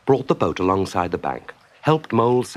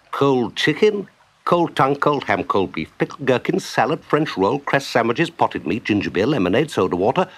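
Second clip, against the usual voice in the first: breathless-sounding run-on catalogue; rattled-off list; 3 semitones higher than usual. Acted. Rat listing the picnic for Mole, rattling it off. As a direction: reel items off without pausing.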